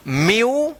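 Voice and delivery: म्यू drawn out as "myoo", rising; model reading of mew. Shown as a demonstration. Teacher gives another example for learners, म्यू lifted at the end. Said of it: "यू उच्चारण होगा".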